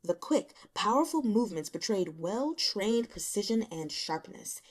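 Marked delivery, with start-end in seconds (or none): none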